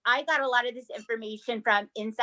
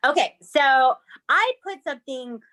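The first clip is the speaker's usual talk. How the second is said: slow, higher, louder spooky tease; spooky host; 4 semitones higher, 5 dB louder than usual. Acted. Host teasing spooky Halloween segment, eerie. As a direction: build eerie suspense. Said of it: "introduces spooky segment".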